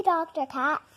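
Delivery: ordinary delivery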